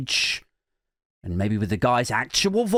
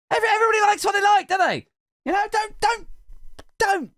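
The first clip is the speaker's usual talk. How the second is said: 1 defensive outburst; much faster than usual. Real, not acted. Defensive rant to cohost.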